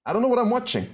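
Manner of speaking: can't believe what he's seeing, pitch shooting up; stunned disbelief. Real, not acted. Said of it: "I cannot believe it".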